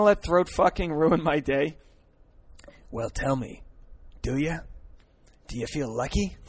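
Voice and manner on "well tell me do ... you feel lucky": low, quiet gravel-free tough guy voice; lightly done movie tough guy; Dirty Harry quote. Retold or imitated. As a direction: quote the cop line, lowered and softer.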